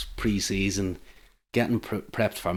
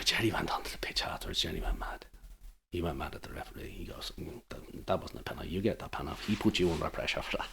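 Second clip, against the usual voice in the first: hushed retelling; 3 semitones lower, 9 dB quieter than usual. Retold or imitated. Retelling manager's rant softly.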